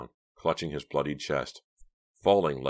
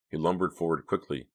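plain reading voice

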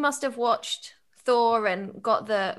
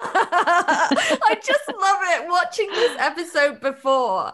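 louder, higher delighted gush; excited laughing; 5 semitones higher, 5 dB louder, slower than usual. Real, not acted. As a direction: laugh through your fan delight. Gushing fan, laughing with delight.

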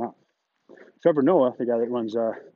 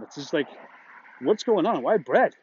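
ordinary talk, nothing marked

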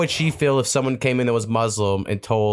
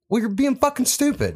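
high mock snap at dad; snapping at dad; 12 semitones higher than usual. Son snapping at his dad, high mock retort. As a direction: retort with a sudden high jab. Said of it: "me being like".

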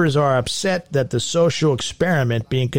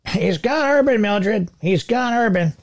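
put-on high fussy old woman; gossiping to Mildred, much higher; old lady voice; 8 semitones higher than usual.

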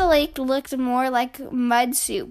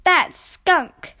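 complaining; 6 semitones higher, 6 dB louder than usual. A narrator's reading. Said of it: "complained Brother".

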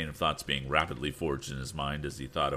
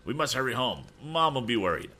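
desperate urging; 7 semitones higher, 3 dB louder than usual. Acted. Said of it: "cried Andy desperately".

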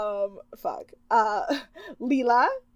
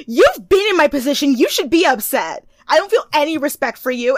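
higher, louder indignant rant; 5 semitones higher, 7 dB louder than usual. Retold or imitated. Kristen lecturing the other girls, loud and indignant. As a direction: scold them like you're wronged.

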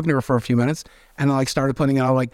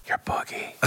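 a sly secret whisper between strangers; 10 dB quieter than usual. Retold or imitated. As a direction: lean in and whisper it, unvoiced. A guy leans over, slick.

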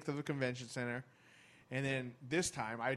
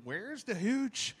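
put-on slurred, high drunken yell; drunk woman yelling; 14 semitones higher, 9 dB louder than usual. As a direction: slur it like a shit-faced drunk. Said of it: "She's yelling".

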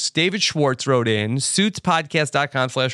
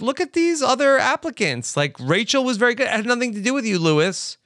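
mock Sheila explaining; 7 semitones higher than usual. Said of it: "we find out she's like".

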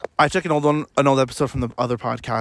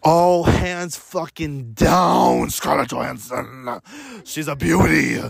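scary voice; 2 semitones higher, slower than usual. Acted. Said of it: "that was a scary voice".

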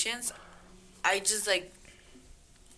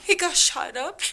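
hammed-up tearful phone confession; mock crying; 4 semitones higher, 3 dB louder than usual. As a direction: imitate a drunk sobbing down the phone, half laughing. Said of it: "He's on the phone crying".

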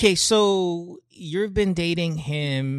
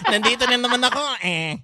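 high teasing jealous whine; whiny and jealous; 8 semitones higher, faster than usual. Retold or imitated. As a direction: pout it out, feeling left out. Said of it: "Look at her say it in a whiny, jealous way".

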